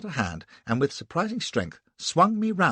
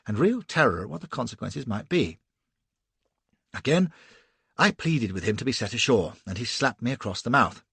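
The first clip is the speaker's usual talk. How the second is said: usual speaking voice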